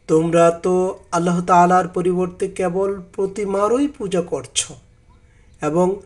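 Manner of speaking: ordinary talk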